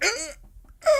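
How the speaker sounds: high, soft strangled "ugh"; frozen tongue-out noise. Retold or imitated. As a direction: squeeze out a small, frozen squeak. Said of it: "she freezes and just like".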